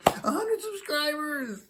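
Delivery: high, drawn-out cheer building to a shout; excited shout. Real, not acted. Vlogger celebrating his hundredth subscriber, cheering to viewers. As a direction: whoop it up like you just won.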